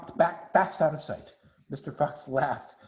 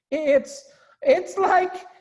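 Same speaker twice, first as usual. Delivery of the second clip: high, loud, very slow and half sung; rapturous gasp; 13 semitones higher, 9 dB louder, much slower than usual. Acted. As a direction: overcome by the taste. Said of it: "he gasped".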